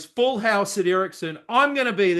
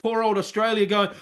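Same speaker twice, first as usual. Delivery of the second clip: usual speaking voice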